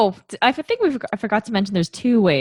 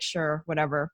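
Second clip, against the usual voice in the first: usual speaking voice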